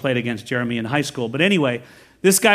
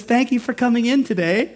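put-on cheerful shop girl; 8 semitones higher than usual. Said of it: "She goes".

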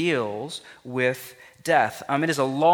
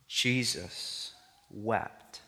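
slow solemn repeat; 4 dB quieter, much slower than usual. Shown as a demonstration. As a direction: draw it out, grieving.

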